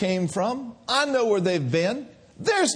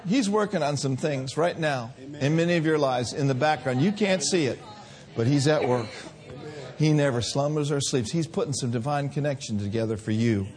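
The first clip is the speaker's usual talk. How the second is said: hushed reverent sermon close; tender preaching; 6 semitones lower, 3 dB quieter than usual. Acted. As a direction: gently draw them into awe. Soft closing reflection.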